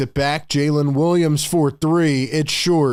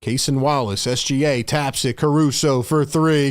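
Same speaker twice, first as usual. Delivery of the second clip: ordinary talk, nothing marked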